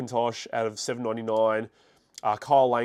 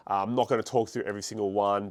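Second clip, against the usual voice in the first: normal talking voice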